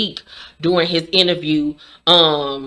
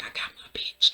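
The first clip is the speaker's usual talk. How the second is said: whispered, smug and satisfied; 11 dB quieter than usual. Retold or imitated. Kane gloating to himself after winning Monet, smug whisper. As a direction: whisper it to yourself, pleased. His look after the kiss.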